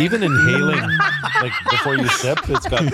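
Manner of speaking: laughing. Real, not acted. Sniffing the fizzy wine.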